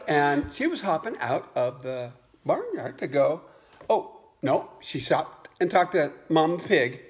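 ordinary delivery

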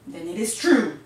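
loud, emphatic proclamation; decreeing. A narrator's reading. Don Diego accepts the newcomer's password, loudly decreeing. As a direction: declare it like a leader's decree. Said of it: "decreed Don Diego".

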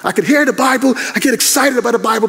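usual speaking voice

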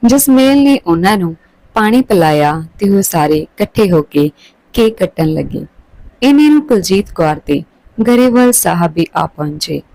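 usual speaking voice

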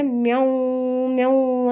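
long, drawn-out mimicked meows; kitten meow imitation. Owner mimicking the kittens' musical crying, drawn-out meows. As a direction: meow like kittens singing, stretching each cry. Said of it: "ಅಂತ ಸಂಗೀತ ಆಡಕತ್ತಾವ".

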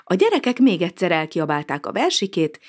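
plain reading voice

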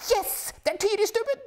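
very high, loud cry rising on Tyristubben; wife cheering the next Norwegian; thrilled shout. Acted. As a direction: burst out with joy. Wife spots Norwegian skier.

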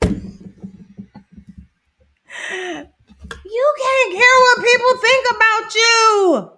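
high, loud and slow, drawn out; preaching. Real, not acted. Urging viewers to ignore others, preaching loud. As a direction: preach it to the crowd.

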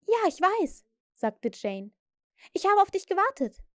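put-on a curious small girl; lightly voiced girl waking up; little girl Jane. A narrator's reading.

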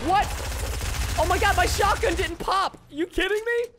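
high-pitched, incredulous protest; outraged disbelief. Real, not acted. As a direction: cry out as if cheated. Shotgun fails in a fight.

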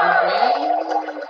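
drawn-out rising call of the name; excited call. Real, not acted. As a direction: stretch the name out as the ball flies. Calling the shot in golf game.

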